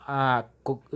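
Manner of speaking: ordinary talk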